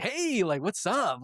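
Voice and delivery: high, cheery mock greeting; lightly hammed-up friendliness; happiest guy. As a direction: play an overjoyed guy saying hi. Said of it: "he's, like, the happiest guy".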